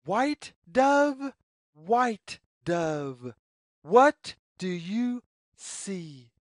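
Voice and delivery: plain reading voice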